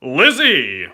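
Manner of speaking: loud booming announcer call; Smash Bros announcer. Retold or imitated. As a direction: announce the name like a fight intro. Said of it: "Like that?".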